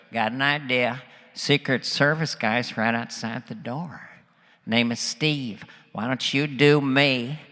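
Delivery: low-pitched presidential impression, scheming; George Bush. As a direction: lay out the prank like a folksy president. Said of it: "He goes".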